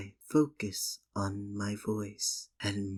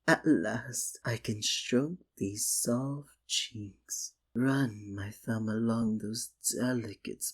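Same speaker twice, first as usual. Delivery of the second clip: normal talking voice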